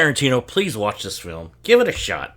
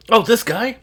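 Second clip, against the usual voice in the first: excited recognition; 12 semitones higher, 8 dB louder than usual. Real, not acted. Recognizes actor on screen.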